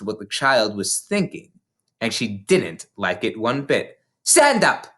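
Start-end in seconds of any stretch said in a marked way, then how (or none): 4.2-4.9 snapped. A narrator's reading. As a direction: snap it like a command. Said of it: "she snapped".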